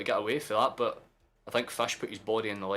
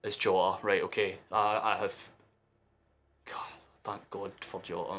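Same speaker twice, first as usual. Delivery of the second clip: ordinary talk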